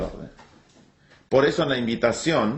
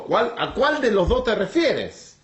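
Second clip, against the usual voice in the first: high, pressing question as Abraham; exasperated, comic plea for clarification; puzzled insistence; 6 semitones higher than usual. Acted. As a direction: ask it insistently, pitch lifted, half amused. Acts puzzled Abraham pressing God.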